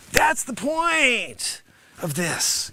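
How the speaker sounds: ordinary talk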